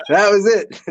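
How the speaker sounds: talking through a laugh; chuckling through the words. Real, not acted. Laughing while telling story.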